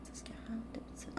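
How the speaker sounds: whispered, slow and soft. Real, not acted. Reader checking the time quietly, whispering slowly. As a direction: breathe the words under your breath, slowly. Reads the time under her breath.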